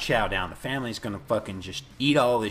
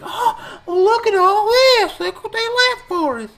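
very high, hammed-up gushing; exaggerated ecstatic imitation, near-tearful; mock excited mom; 7 dB louder, slower than usual. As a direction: squeal with slow, loud, overblown delight. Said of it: "she's excited about this fucking food".

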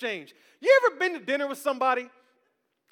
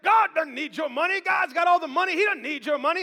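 higher, louder hammed-up pulpit voice; mock preacher; 6 semitones higher, 5 dB louder than usual. Pastor mocking other preachers, louder hammed-up preaching. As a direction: imitate a preacher booming at the flock. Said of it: "Y'all ever heard that".

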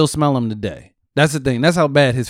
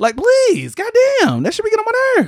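much higher, pleading whine; fed-up high-pitched complaining; high mock exasperation. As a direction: whine like it's unbearable. Continues high mock rant.

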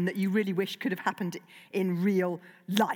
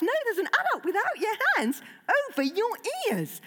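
mock outrage; 12 semitones higher than usual. Real, not acted. Catching an adult not covering ears.

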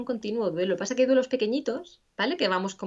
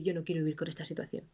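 softer, quick inner refusal, a touch of sadness; quietly voiced refusal; 3 semitones lower, 8 dB quieter, faster than usual. Therapist voicing a grieving person's inner thought, quiet and quick. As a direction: murmur it fast, like a thought you hardly admit. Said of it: "Y es humano decir".

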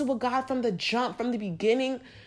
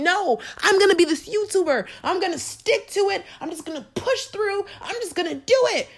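much higher, insistent self-mockery; stubborn younger self; 9 semitones higher than usual. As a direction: play your stubborn old self, pitched up high. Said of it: "Imagine if I was still holding".

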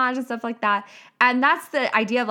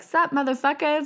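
high cheeky "Sup, motherfuckers?"; brash greeting; 6 semitones higher than usual. Retold or imitated. Showing up online, greeting followers with cheeky swagger. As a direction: toss it out like you own the room. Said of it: "I was like".

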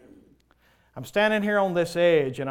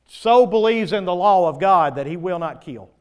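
loud preaching; 2 semitones higher, 9 dB louder than usual. Acted. Emphatic preaching.

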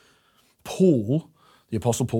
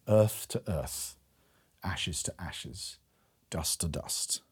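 slow, softer, solemn committal words; solemn liturgy; 6 semitones lower, 10 dB quieter, slower than usual. Acted. Preacher reading the funeral service, hushed and slow. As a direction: let each phrase fall gently, slowly.

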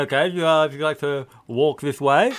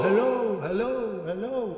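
hello fading away higher and softer; imitated echo; 6 semitones higher, 7 dB quieter, slower than usual. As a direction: voice your own echo, dying out. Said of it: "There's a bit of an echo going on".

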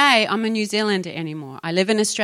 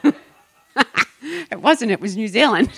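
ordinary talk

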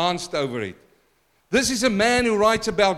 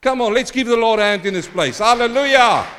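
loud, fast call for applause; rally the room; call to praise; 3 semitones higher, 6 dB louder than usual. Acted. Preacher rallies church, loud and fast.

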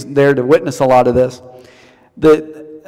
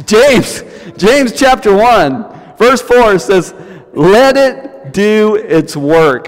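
higher, louder and slow; emphatic preaching; 6 semitones higher, 5 dB louder, much slower than usual. Real, not acted. Preacher proclaims the scripture to the congregation, louder and higher. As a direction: proclaim the verse with force.

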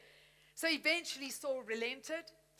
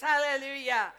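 ordinary talk

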